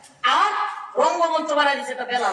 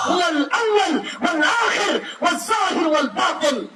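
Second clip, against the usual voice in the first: fiery preaching; 6 semitones higher than usual. Acted. Preacher booming verses to the mahfil crowd, fiery chant. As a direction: thunder the verses out like a revival preacher.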